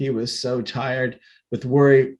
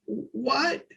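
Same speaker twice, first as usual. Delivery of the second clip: high, thin squeak; squeaky voice; 13 semitones higher, 8 dB quieter than usual. A narrator's reading. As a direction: squeak it out in fright. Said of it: "in a squeaky voice".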